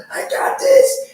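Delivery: strained, drawn-out dwarf yelling high; a wounded, furious dwarf forcing the words out; angry dwarf. Acted. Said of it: "he's angry".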